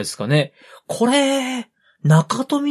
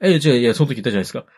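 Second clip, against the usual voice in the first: ordinary talk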